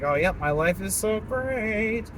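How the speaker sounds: slow, drawn-out mock boasting; mock self-serving brag. Imitating social media users showing off, drawn-out mock brag. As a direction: stretch out the last words like a smug poster. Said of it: "self-serving, like".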